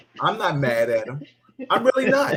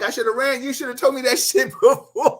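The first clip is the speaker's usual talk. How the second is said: laughing retort; 10 semitones higher, 4 dB louder than usual. Retold or imitated. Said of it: "I'm like".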